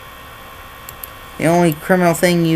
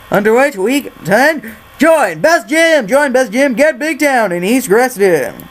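raised, sing-out sales pitch voice; gym promoter; 6 semitones higher, 4 dB louder than usual. Pretend. Promoter pushing Best Gym, hammed-up high voice. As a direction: hawk the gym in a put-on character voice.

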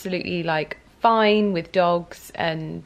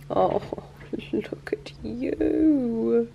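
tender whisper; 6 semitones higher, much slower than usual. Real, not acted. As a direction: whisper lovingly to the purring cat. Whispering fondly to purring cat.

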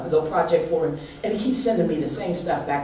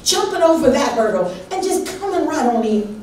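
higher, louder, fervent sermon voice; full-force preaching; 8 semitones higher, 3 dB louder than usual. Acted. Pastor preaching to her church at full force. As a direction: preach it to the rafters, building.

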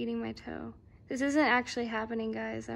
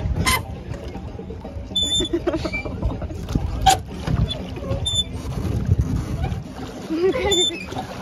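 long airy fit of laughter, high; giggling without voice, can't stop; long breathless laugh; 7 dB louder than usual. Real, not acted. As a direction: laugh helplessly for a while. Laughing in the boat.

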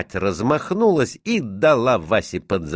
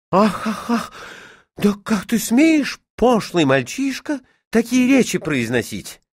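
high, gasping motherly outrage; scandalised exclamation; 14 semitones higher, 3 dB louder than usual. A narrator's reading. Mother aghast at her son's vulgar talk, scandalised. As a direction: exclaim in shocked indignation. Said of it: "воскликнула мать".

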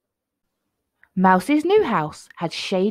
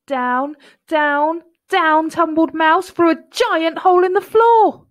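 loud, high narration, climbing with each "down"; tumbling excitement; 8 semitones higher, 9 dB louder than usual. A narrator's reading. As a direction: build it like a long fall.

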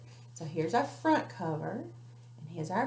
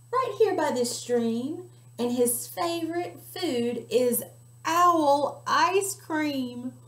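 higher, louder, then slow and drawn out; teasing mouse; 7 semitones higher, 7 dB louder, slower than usual. Acted. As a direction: savour the threat. Mouse warns owl.

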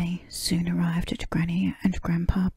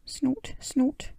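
pig snort; 7 semitones higher than usual. Acted.

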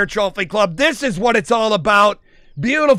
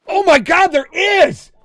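high, loud excitement; amazed outburst; 8 semitones higher, 5 dB louder, slower than usual. Real, not acted. Streamer sees two level fives, amazed outburst. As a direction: cry out in real astonishment. Confirms the rare double find.

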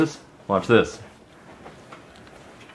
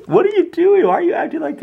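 much higher, louder teasing squeal; playful squeal; 3 dB louder than usual. Real, not acted. Teasing toddler.

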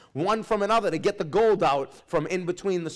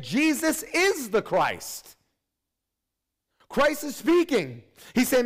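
fiery preaching; 7 semitones higher than usual. Acted. Pastor urging his church about the last days, full-force preaching. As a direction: drive the point home to the congregation.

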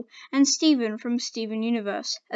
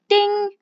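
chime imitation; 10 semitones higher, 8 dB louder than usual. Door chime sound effect.

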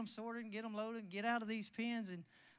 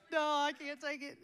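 laughing protest; 5 semitones higher, 6 dB louder than usual. Real, not acted. Laughing at the offer.

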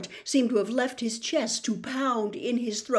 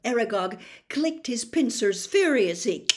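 plain reading voice

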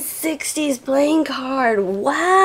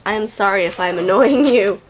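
ordinary talk, nothing marked

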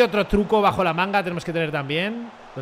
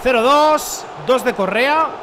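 loud, high scoreline announcement; exultant score call; 6 semitones higher, 7 dB louder, slower than usual. Real, not acted. Streamer announcing Correa's second goal, exultant call. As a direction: proclaim the goal like a commentator.

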